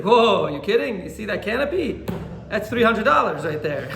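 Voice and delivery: loud, incredulous boast, breaking into a laugh; loud boasting exclamation. Retold or imitated. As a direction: brag about the price as if it's obvious. Neighbor boasting about his sukkah's cost.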